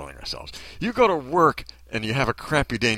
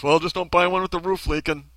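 higher mock-helpful quip; lightly mocking, pitched up; mock glib advice; 6 semitones higher, 4 dB louder than usual. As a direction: parody someone handing out useless advice. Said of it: "Are they gonna go".

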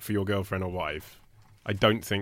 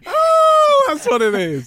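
surprised shout; 10 dB louder, much slower than usual. Real, not acted. Sudden realisation, shout.

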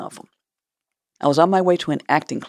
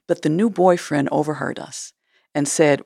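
ordinary talk, nothing marked

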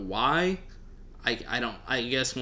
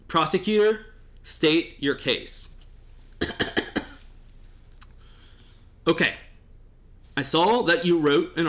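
mock judge; 4 semitones higher than usual. Host as judge Rattlesnake opening the fake trial, mock solemn. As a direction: play-act a judge calling on the prosecutor. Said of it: "I'm Rattlesnake, the judge".

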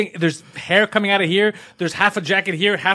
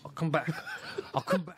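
quiet mumbling; 13 dB quieter than usual. Retold or imitated. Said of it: "He's like".

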